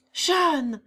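loud high cry of relief. A narrator's reading. Guillaume finding Jeanne alive, crying out loud. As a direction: call her name out as you drop to your knees. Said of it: "s'écria Guillaume".

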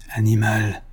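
ordinary delivery

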